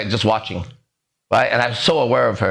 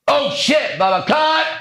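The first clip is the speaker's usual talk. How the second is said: high, strained shout of fake shock; overacted surprise; 13 semitones higher, slower than usual. Actor overdoing a surprised line on set, high forced yelp. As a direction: push it way too hard, much higher. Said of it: "I'm gonna make it real".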